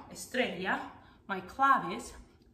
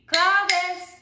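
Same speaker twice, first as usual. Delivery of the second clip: higher, louder call of the name; 5 semitones higher, 6 dB louder than usual. Shown as a demonstration. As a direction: call it out for children to copy. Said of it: "as you call the name claves".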